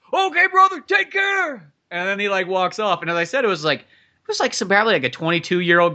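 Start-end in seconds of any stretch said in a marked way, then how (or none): 0.1-1.7 high, loud imitated send-off; mock shouted farewell; retold holler. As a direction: call out a goodbye like a rowdy stranger. Retold stranger calling a loud goodbye.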